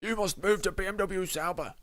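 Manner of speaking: high, put-on demanding voice; mock demanding. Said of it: "Thought you're demanding".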